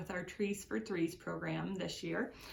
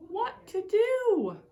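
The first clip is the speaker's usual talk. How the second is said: high, slow anguished cry; a lonely old man crying for help; 12 semitones higher, 8 dB louder, much slower than usual. A narrator's reading. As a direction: wail it to the sky. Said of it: "and the man cried".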